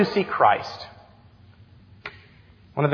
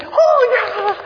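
imitating a child's very high, giggly squeal; daughter's excited squeal; 4 dB louder than usual. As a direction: squeal like a delighted little kid. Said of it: "And she went".

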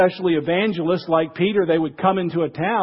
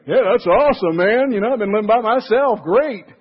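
louder, higher, fast greedy delight; loud eager gusto; 6 semitones higher, 6 dB louder than usual. Retold or imitated. Twenty-three-year-old eating everything they have, loud and eager. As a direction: whoop it up like a hungry kid at the fridge. Young man raiding the fridge.

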